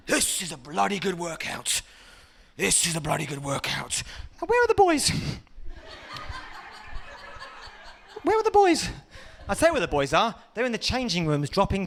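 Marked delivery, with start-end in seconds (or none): 0.1-4.0 smug gym bloke. Retold or imitated. Skipping man after cross trainer. 4.4-8.9 much higher, eager calling voice; eager gym lad. Acted. Gym lad looking for the boys, high eager call. Gym guy seeking mates.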